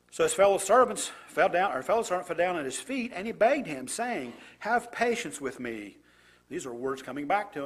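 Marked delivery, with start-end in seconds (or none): 0.1-6.0 louder, deliberate Bible reading; scripture reading. Acted. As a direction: read the passage out to the congregation with weight. Reads scripture passage aloud.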